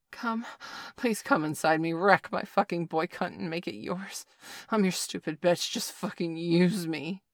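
loud, desperate begging cry. A narrator's reading. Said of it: "Bailey cried out in a trance".

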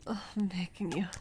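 ordinary talk

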